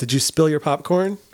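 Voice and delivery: ordinary talk, nothing marked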